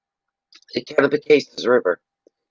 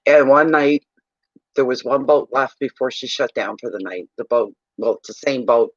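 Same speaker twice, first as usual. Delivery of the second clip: ordinary talk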